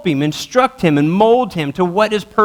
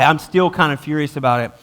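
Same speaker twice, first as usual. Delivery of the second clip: usual speaking voice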